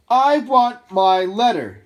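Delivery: slow, loud, high shout. A narrator's reading. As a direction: shout it, drawing out every word. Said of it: "he shouted".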